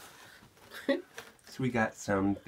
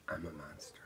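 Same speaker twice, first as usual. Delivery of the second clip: whisper; 8 semitones lower, 15 dB quieter than usual. Acted. Mock confession.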